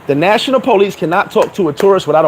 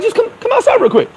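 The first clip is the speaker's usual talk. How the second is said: mock coaxing cop. Cops coaxing him out.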